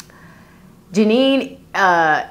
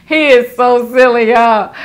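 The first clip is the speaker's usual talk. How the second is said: laughing; 4 semitones higher, 6 dB louder than usual. Real, not acted. Reacting to the hubby joke.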